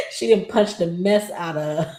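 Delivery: laughing through the words. Real, not acted. Vlogger laughing while recapping the punch. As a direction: giggle it out mid-sentence.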